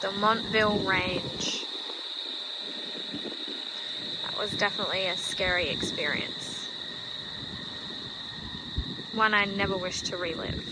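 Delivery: quiet, heavy looking back; somber. Real, not acted. Somber reflection at the range.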